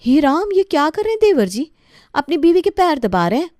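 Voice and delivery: high, loud shocked exclaim; wife aghast at the photo, loud and high; shocked gasp. Acted. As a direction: gasp at the scandal.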